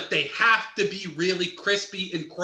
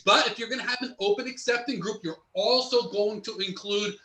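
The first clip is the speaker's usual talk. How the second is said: ordinary talk, nothing marked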